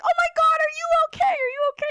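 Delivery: panicked concern, pitched high; alarmed. Retold or imitated. Coworker rushes over alarmed.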